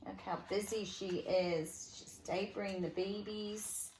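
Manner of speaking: usual speaking voice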